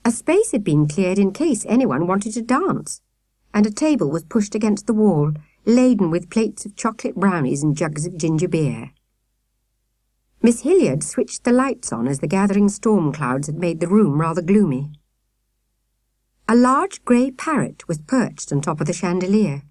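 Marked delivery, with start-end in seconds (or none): none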